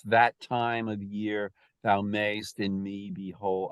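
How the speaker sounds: every second syllable leaned on, sing-song; exaggerated iambic meter. Shown as a demonstration. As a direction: read it like a metronome da-DUM. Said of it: "I'm exaggerating".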